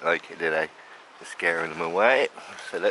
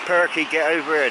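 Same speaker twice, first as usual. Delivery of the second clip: loud, higher call across the garden; 8 semitones higher, 6 dB louder than usual. Real, not acted. Calling the pigeon Perky over to the food, loud call. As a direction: call the bird over to you.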